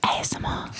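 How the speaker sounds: breathy whispered ASMR. Pretend. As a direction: whisper it close to the mic.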